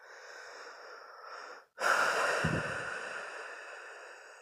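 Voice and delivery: drawn-out exhale, swelling then fading; slow, weighty breath out; long heavy sigh. Real, not acted. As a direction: let out a big, long sigh. Long sigh while reflecting.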